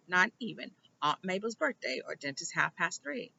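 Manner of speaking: ordinary delivery, nothing marked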